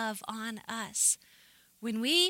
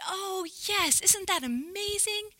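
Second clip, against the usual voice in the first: gushing delight; 8 semitones higher than usual. Retold or imitated. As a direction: gush it in a raised voice, imitating naive delight. Retelling her own delighted answer.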